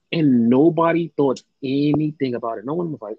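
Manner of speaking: normal talking voice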